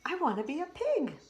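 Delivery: put-on high, eager child frog; a small frog wishing aloud; squeaky pleading kid; little frog voice.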